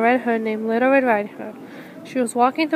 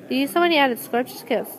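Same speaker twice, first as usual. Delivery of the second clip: usual speaking voice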